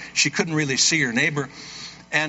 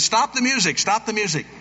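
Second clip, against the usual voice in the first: urgent call; 7 semitones higher than usual. Retold or imitated. Pastor halts the invitation.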